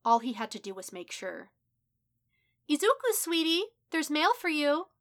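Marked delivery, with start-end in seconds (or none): 2.7-4.9 high, loud, singsong mother's call. A narrator's reading. Mother calls her son about the mail, high loud call. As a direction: call sweetly to your son, pitched up and loud. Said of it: "His mother's voice cut through his haze".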